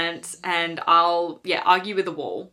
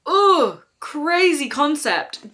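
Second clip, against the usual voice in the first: disgusted ugh; 7 semitones higher, 3 dB louder, much slower than usual. Real, not acted. Disgust at the plot.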